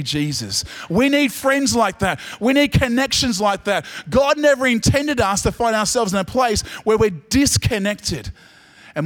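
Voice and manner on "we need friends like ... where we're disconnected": high, loud preaching over music; fervent preaching. Acted. Pastor urges the church toward connection, fervently. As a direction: build the call like a preacher.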